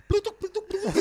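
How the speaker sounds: high-pitched "plutuk" bubble pops; imitated popping noise. Podcast guest joking about a bubble airbag, mimicked pops. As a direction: pop the syllables high, like bubbles.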